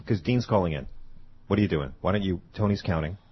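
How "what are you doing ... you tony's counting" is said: ordinary talk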